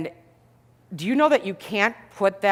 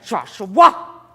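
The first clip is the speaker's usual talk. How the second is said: sharp call; 9 dB louder than usual. Retold or imitated. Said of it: "I said".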